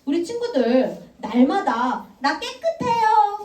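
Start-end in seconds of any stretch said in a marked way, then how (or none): 2.2-3.4 high, slow, self-satisfied; smug claim. Shown as a demonstration. Teacher mocking kids who claim to be clean, smug high. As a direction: say it like a proud innocent. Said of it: "손 들어보세요".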